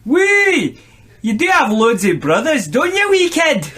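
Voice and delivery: high, lilting Scottish character voice; hammed-up Scottish, sounding out the spelling; Scottish accent. A narrator's reading. Judo instructor talking to Nooch, Scottish accent. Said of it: "your best Scottish accent in this one".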